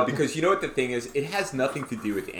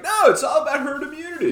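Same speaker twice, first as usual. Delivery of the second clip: mocking quote voice; 14 semitones higher, 5 dB louder, faster than usual. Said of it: "who's like".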